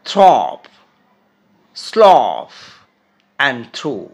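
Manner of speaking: each of থ্রপ, স্লফ, through said apart and loud; teacher's word list; model words. Shown as a demonstration. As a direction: read out thorough, slough, through. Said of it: "এ শব্দগুলো খেয়াল করো".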